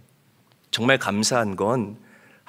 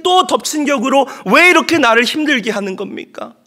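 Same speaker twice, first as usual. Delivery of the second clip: loud, high wailing plea to God; crying out to God; 13 semitones higher, 9 dB louder than usual. Acted. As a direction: cry out in desperate anguish, half out of her mind. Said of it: "하나님께 소리내어서 우는 거예요". (하나님께 울부짖는 절박한 외침)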